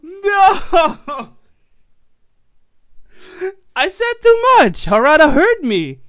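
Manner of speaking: high, loud wail; despairing shout. Real, not acted. Mock despair after loss.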